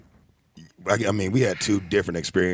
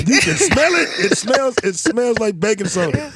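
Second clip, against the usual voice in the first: excited; 12 semitones higher, 5 dB louder than usual. Real, not acted. Excited joking about the show.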